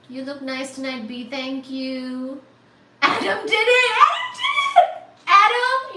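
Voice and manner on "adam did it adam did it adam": loud, climbing squeal of triumph; ecstatic cheering. Real, not acted. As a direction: shriek it higher as you celebrate. Celebrating the donor.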